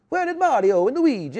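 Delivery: hammed-up Mario voice; mock Italian. Joking about landing in Italy, hammed Italian accent. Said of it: "the first thing I said when I got off the plane".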